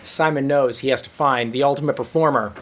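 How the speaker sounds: ordinary talk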